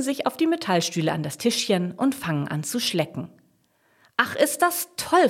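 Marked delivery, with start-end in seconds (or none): 4.2-5.3 high, slowly savoured delight. A narrator's reading. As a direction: exclaim it with drawn-out, high-pitched joy. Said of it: "freut sich Jasmin".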